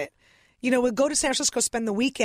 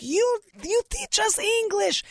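put-on much higher, slow broken Russian-accented English; 10 semitones higher, slower than usual. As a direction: play immigrant parents thanking their TV teacher. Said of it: "complimenting her in their broken English".

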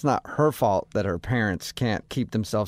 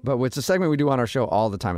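normal talking voice

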